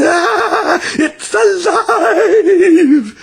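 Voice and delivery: high, loud, drawn-out shriek; terrified scream. A narrator's reading. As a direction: scream in horror. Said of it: "The lady screamed".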